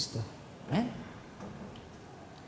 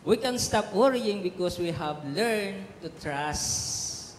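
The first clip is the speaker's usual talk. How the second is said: a slow, weighty quotation; slow solemn reading; much slower than usual. Acted. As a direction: read each word slowly and solemnly. Slow quote read out. (mabagal at taimtim na pagbasa)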